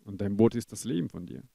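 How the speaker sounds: loud, driving sermon delivery; full-force preaching. Acted. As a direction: preach hard to the church family.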